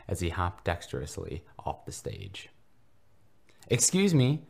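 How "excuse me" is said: put-on much higher, louder innocent boy calling; innocent cry. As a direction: call out timidly but eagerly. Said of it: "the young believer cried innocently".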